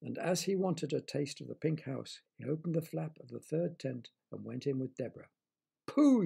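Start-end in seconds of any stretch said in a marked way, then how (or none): 5.9-6.2 loud high cry of disgust; a child's loud disgusted yell; yelled pooh. Acted. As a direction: yell it at the stink. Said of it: "yelled Derek".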